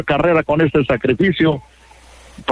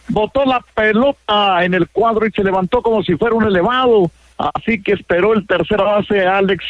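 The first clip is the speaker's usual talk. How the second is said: excited play call; 7 semitones higher than usual. Real, not acted. Play-by-play announcer calling the infield hit for radio listeners, excited and higher. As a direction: pitch climbing higher as the play unfolds.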